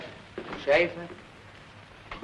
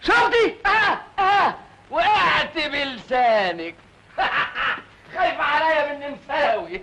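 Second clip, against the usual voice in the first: much higher, slowing at the end; triumphant gotcha; 9 semitones higher than usual. Acted. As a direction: crow over catching her out. Catches her out triumphantly.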